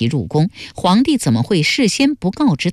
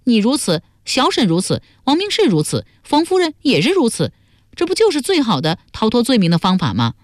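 higher-pitched character arguing a case; animated young schemer; 6 semitones higher than usual. Acted. Laying out the perfect cover-up to companions, animated. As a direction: argue it out in a higher voice.

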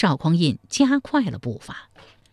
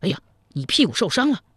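quick, mildly startled exclamation; contained cry of surprise; 3 semitones higher, faster than usual. A narrator's reading. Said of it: "他惊叫一声说".

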